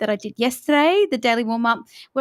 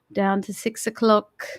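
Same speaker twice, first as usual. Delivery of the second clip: usual speaking voice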